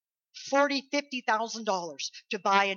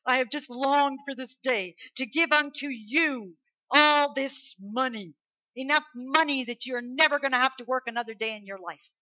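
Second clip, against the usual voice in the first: raised, emphatic, excited announcement; generous boss; 4 semitones higher, 6 dB louder than usual. Acted. Boss giving workers a fortune, excited. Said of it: "our boss calling us in and said".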